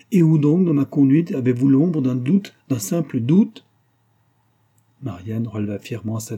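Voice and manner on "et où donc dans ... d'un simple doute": raised, loud outraged questioning; indignant challenge. A narrator's reading. Husband rejects his wife's jealousy, indignant challenge.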